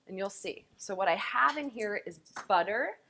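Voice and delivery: usual speaking voice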